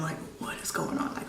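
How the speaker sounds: hushed, frightened whisper; soft breathy whisper; whispered question. Retold or imitated. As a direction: whisper it, dreading the answer. Repeats it hushed.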